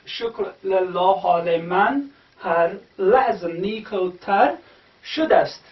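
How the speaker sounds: slow, careful reading of the line; slow recitation. Shown as a demonstration. Teacher slowly reading the verse to students. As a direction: read each word slowly and carefully. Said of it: "you can again see here".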